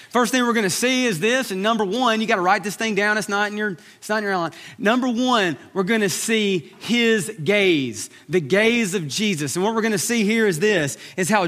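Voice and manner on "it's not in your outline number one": ordinary talk, nothing marked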